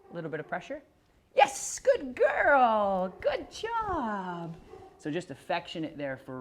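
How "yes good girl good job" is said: high, slow, drawn-out praise for the dog; sing-song praise for a pet; happy dog praise. Real, not acted.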